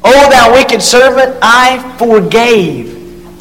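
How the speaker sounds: "forgave" slowed right down and leaned on; slow, weighty "I forgave"; stress on forgave. Shown as a demonstration. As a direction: drag out "I forgave" for emphasis. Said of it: "there's a key word again".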